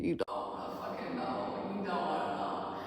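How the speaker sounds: hushed, whispery aside; inner thought. Retold or imitated. Silent answer to his friends' question, hushed inner voice. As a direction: mutter it very softly as a secret thought. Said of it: "And in my head".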